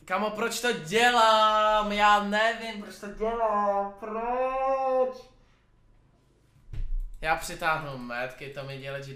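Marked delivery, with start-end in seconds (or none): 0.0-5.2 exasperated. Real, not acted. Exasperated at self.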